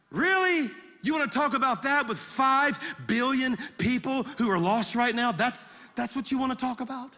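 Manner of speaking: acted indignant reply to a petty request; can't believe you'd ask that; incredulous rebuke. God's indignant reply to a trivial prayer.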